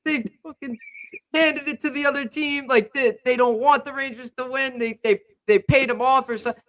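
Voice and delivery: high-pitched retold rant about the refs; worked-up mock outrage, high and spluttering; flipping out. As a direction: imitate a friend going crazy, pitch way up. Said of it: "he was flipping out on the refs".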